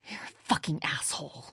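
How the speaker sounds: soft, breathy hissed whisper; whispered insult. Acted. Daughter insulting Lee after her dad's death, bitter whisper. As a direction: spit it out under your breath.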